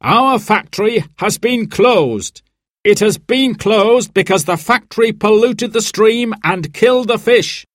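high, emphatic speech-making; addressing a meeting. A narrator's reading. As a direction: announce it to the whole hall. Manager addresses a crowded meeting.

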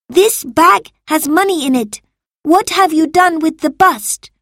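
high, loud demand for answers; angry accusation. A narrator's reading. Marcus glaring at Salvius over coins, accusing. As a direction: accuse the servant of theft. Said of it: "Marcus glared at Salvius".

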